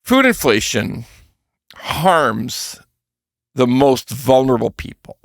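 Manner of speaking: slow, heavy stress on each phrase with long pauses; emphatic. Shown as a demonstration. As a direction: spell it out word by word, as emphatically as you can. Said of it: "let me say this in the most emphatic way".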